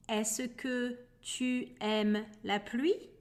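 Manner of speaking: slow, careful teacher repeat of est-ce que tu aimes la pluie. Shown as a demonstration. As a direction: say it slowly so learners catch each word. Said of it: "Let me say it again".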